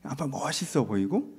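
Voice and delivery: ordinary delivery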